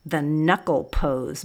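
ordinary delivery, nothing marked

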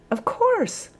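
very high, sudden realisation; light up at the idea; dawning realisation. A narrator's reading. Sergeant seizing the corporal's idea, brightening. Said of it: "The sergeant's eyes brightened".

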